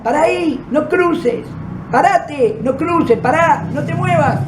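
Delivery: a man calling across the street to stop; retold yelling. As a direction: call out to a stranger about to cross. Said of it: "alguien empieza a gritar".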